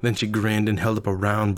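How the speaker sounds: plain reading voice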